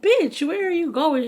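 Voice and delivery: high, loud, disbelieving retold outburst; mock-indignant shout, pitched well up; incredulous call-out. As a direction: call after a friend, re-enacting disbelief. Calls after friend walking off.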